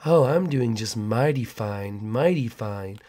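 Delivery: low, soft, slow creepy neighbor voice; slow it down like a sinister neighbor; creepy neighbor drawl. Acted. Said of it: "why do you talk like that".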